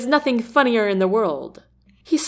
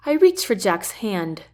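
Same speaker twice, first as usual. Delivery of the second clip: ordinary delivery, nothing marked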